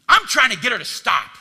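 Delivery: loud, frustrated protest; exasperated outburst. Acted. Pastor voicing himself answering God, loud exasperated protest. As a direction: blurt it out in frustration. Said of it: "acted complaint to God".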